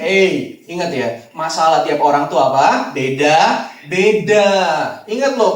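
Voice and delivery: normal talking voice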